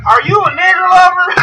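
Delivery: high, exaggerated accusing question; mock Southern local. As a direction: caricature an accusing small-town Southerner. Mock small-town bigot routine.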